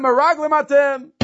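high, loud accusing bark of a stern ruler; harsh accusation. Retold or imitated. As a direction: fling the charge at the brothers. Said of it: "he speaks harshly with them".

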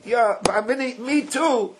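ME TOO pushed up high for emphasis; high-pitched, insistent stress on ME TOO; emphatic. Acted. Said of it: "I'm emphasizing".

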